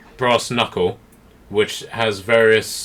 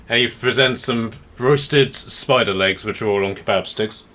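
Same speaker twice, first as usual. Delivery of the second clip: ordinary talk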